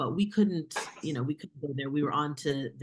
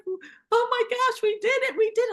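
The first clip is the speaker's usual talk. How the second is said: imitating a higher, louder voice choked with happy tears; retold tearful joy; 7 dB louder than usual. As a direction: play the director half-crying with joy, much higher and louder. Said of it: "like, weeping and barely, you know, understandable".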